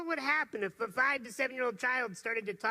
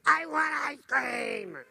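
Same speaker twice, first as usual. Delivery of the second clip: put-on loud, slow, insistent child demand; a persistent child demanding ice cream; whining child shout; 5 semitones higher, 5 dB louder, slower than usual.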